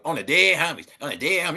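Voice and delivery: lightly mocking wannabe gangbanger chant; wannabe gangster. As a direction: mimic a fake gangster swearing an oath. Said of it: "non-affiliates out here be like".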